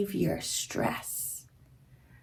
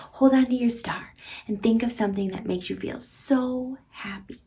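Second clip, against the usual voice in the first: ordinary talk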